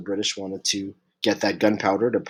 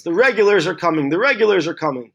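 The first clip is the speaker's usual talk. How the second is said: raised, higher mock town-crier yell; imitated yell; 7 semitones higher, 4 dB louder than usual. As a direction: call out the warning like Revere, voice lifted but not full shout. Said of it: "he yells".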